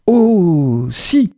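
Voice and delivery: quick triumphant exclamation; exclaiming. A narrator's reading. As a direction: burst out eagerly, as if caught on a great idea. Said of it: "s'exclama-t-elle".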